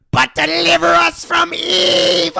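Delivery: high, loud, drawn-out demonic cry; mock possessed voice. Possessed girl breaking into the priest's prayer, high demonic cry. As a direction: drag it out high like someone possessed.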